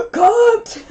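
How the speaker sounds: loud, high gasped reply; hammed-up fight for air; mock breathless. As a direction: gasp it out as if you can't breathe. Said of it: "couldn't breathe and was trying".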